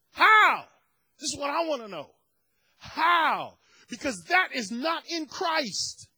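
rising preacher's rebuke; preaching challenge. Acted. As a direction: demand an answer from the pews. Preacher pressing the question.

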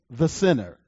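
"the sinner" pressed down with a falling tune; emphasis on sinner. Shown as a demonstration. Preacher reading scripture, emphasising "the sinner". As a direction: set "the sinner" apart with weight. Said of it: "Emphasis added".

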